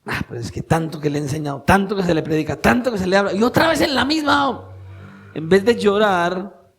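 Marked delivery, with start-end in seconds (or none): 0.0-4.7 mock exasperation. Preacher imitates someone angered by others' repeated sins, exasperation building. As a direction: act out mounting irritation, each "tanto" climbing. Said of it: "más bien uno como que se enoja".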